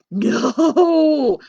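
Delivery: a loud, sharp refusal; shouted no. Acted. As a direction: bark the no, laughing. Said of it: "I said".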